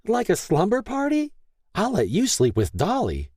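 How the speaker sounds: put-on high, squealing little girl; delighted child. A narrator's reading. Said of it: "Noelle squealed in delight".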